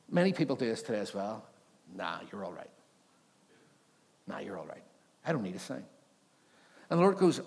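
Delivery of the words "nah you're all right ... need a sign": low, soft, casual brush-off; offhand refusal. Acted. Ahaz turns down God's offer of a sign, offhand. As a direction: wave the offer away quietly.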